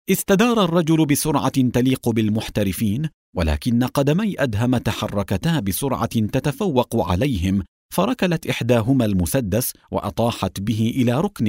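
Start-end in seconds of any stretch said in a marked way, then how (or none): none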